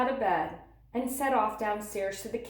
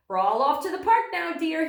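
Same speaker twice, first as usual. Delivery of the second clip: higher, louder, fast cheery announcing; cheerful announcement; 7 semitones higher, 5 dB louder than usual. Acted. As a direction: announce the outing brightly. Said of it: "he announced".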